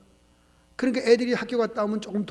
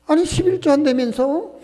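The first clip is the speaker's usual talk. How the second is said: mocking jeer; 8 semitones higher, 6 dB louder than usual. Neighbors jeer passing the shop.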